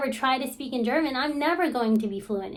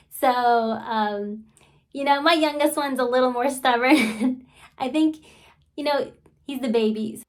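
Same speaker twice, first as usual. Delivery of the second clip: ordinary talk, nothing marked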